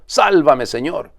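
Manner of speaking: high, loud cry for help; desperate cry. A narrator's reading. Peter sinking in the waves, desperate cry to Jesus. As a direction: cry out as you sink. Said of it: "gritó".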